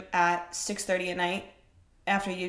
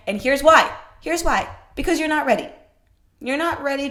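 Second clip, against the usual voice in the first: loud emphasis; 6 semitones higher, 9 dB louder than usual. Real, not acted. Building a point loudly.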